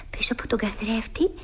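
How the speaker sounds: hushed and secretive; mysterious whisper. Acted. (μυστηριώδης ψίθυρος)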